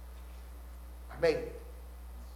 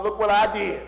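loud, high boastful voice; mock bragging; 3 semitones higher, 7 dB louder than usual. Preacher imagining a boaster in heaven, loud. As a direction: show off like a proud church member.

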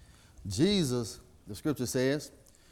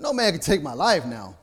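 louder, rising preacher's cry; preaching at full force; 5 semitones higher, 6 dB louder than usual. Acted. Preacher voicing Jesus' power over death, loud. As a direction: proclaim it with triumph.

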